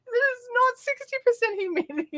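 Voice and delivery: high mock-indignant retort to the forecast; scolding the weather report, slowing at the end; mock accusation. Vlogger retelling her reply to the forecast, mock indignant. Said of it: "I'm like".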